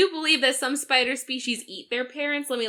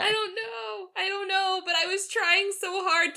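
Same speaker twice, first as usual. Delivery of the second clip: soft upset whimper; 5 semitones higher, 7 dB quieter than usual. Acted. Kepri upset over spiders.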